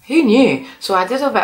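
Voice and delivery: high, loud mock surprise; bright surprised exclamation; who knew. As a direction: toss it off in amazement. Surprised aside about Tasmania.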